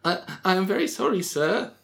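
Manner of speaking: high, meek clerk voice, stammering an apology; timid clerk. Acted. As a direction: squeak it out like a frightened employee. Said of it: "said Skunk".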